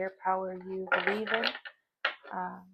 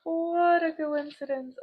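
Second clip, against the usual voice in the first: higher, louder and slow, drawn out in wonder; amazed exclamation; 8 semitones higher, 10 dB louder, slower than usual. Real, not acted. Reader amazed at a card that matches, slow wondering exclamation. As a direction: marvel out loud at the coincidence.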